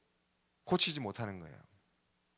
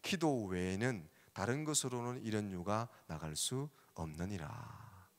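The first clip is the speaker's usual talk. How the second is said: slow, much softer, fading to a hush; slow solemn quote; 5 semitones lower, 8 dB quieter, slower than usual. Acted. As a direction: let the words sink in slowly. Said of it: "예수님이 하신 대답이". (천천히 낮아지며 잦아드는 엄숙한 말씀 인용)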